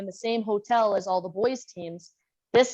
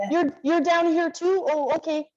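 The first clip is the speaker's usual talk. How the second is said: higher, hesitant mock question; imitating surprised boys; 7 semitones higher than usual. Boys find coach at the tournament, imitated as taken aback. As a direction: play boys thrown to find their coach there. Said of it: "they see me and they go".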